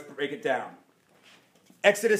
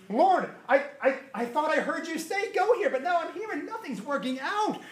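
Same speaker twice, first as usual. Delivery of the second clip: higher, stammering complaint; anxious believer; 7 semitones higher than usual. Acted. Believer telling the Lord nothing works out. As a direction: sound lost and confused.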